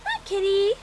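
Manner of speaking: high, coaxing calls to a pet. Real, not acted. Owner calling her hiding cat out with Whiskas in high baby talk. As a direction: lure the kitty out, high and sing-song.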